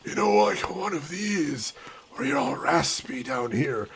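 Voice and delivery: put-on raspy old man voice. Shown as a demonstration. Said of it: "over-the-top old man voice".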